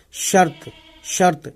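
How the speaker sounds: "شرط" in one syllable, shart; closed final consonants. Shown as a demonstration. Teacher showing learners where sound ends, "شرط" pronounced shart. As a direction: run r straight into t in "شرط". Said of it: "تے پہ ختم کر دینا ہے".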